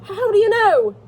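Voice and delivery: high, loud cry of alarm; horror-struck. A narrator's reading. Levin presses his brother for news, horror-struck. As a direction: demand it in acted horror. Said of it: "cried Levin, horror-struck".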